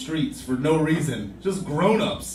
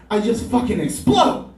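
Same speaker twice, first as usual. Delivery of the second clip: ordinary talk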